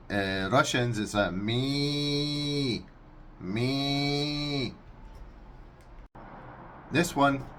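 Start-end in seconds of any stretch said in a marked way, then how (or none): 1.0-4.7 very slow, drawn-out bleating me; goat bleat impression. Shown as a demonstration. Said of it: "for the Russians".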